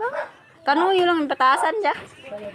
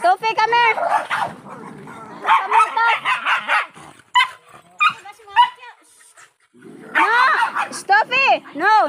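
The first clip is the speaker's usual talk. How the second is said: loud, higher calls to a pet; calling the dog; 5 semitones higher, 4 dB louder than usual. Real, not acted. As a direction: call the dog back firmly.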